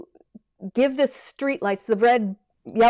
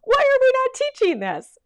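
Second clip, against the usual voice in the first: high, louder "Why are we not teaching this"; mock disbelief that nobody teaches it; exasperated realization; 5 dB louder than usual. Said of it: "I was like".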